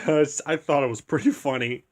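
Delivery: normal talking voice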